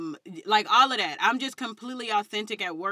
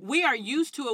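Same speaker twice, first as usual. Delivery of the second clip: normal talking voice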